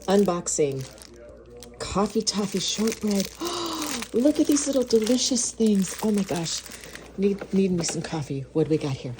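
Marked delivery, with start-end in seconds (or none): 3.3-4.1 quick high, soft gasp; delighted gasp. Real, not acted. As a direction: gasp at a lovely find. Spots the little treats.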